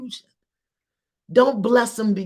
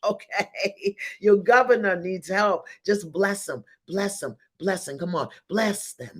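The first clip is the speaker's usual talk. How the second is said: ordinary talk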